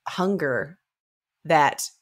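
ordinary delivery

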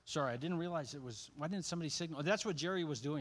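usual speaking voice

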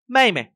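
mê high, loud and falling, mày light; stress on mê. Shown as a demonstration. Teacher models the stressed syllable, mê punched. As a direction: hit mê and glide over mày. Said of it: "trọng âm cũng ra vào từ này".

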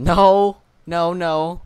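shouted no, no, no; loud protest. Real, not acted. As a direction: yell it out in protest. Refusing German language play.

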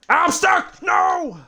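very high, loud cry for help; shrieked mock distress; mock panicked shout. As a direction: yell in hammed-up panic, sky-high. Mock panic at being stuck.

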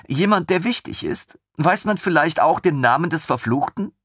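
put-on high, excited young officer; lieutenant thrilled by the idea; eager lieutenant. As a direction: bubble over with enthusiasm. Lieutenant voice continues.

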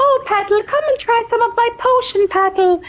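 Auntie Ethel. Acted. Hag introducing herself to Patel, high put-on voice. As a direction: screech it as a cartoon hag. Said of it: "I'm Auntie Ethel the hag".

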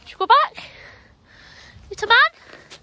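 high, doting pet voice, slow at the end. Real, not acted. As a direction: coo at the little dog. Baby talk to the dog.

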